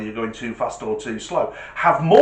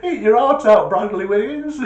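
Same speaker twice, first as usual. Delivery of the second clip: laughing; 8 semitones higher, 6 dB louder than usual. Real, not acted.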